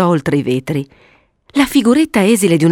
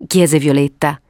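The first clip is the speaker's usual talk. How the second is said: usual speaking voice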